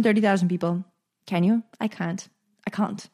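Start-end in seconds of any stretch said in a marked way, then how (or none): none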